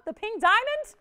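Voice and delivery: high, loud disbelief; astonished question. Real, not acted. Host hearing callers want the pink diamond, astonished question. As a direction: shoot up high in surprise.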